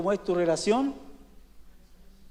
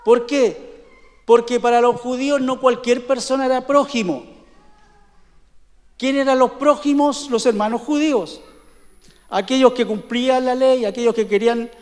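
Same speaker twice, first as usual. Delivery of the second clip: a louder preacher's voice, driving each point home; forceful preaching; 2 semitones higher, 4 dB louder than usual. Acted. Pastor driving the point home to his congregation, forceful preaching.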